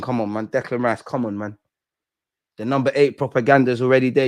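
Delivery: ordinary talk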